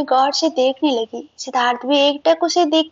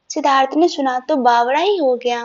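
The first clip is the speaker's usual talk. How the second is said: usual speaking voice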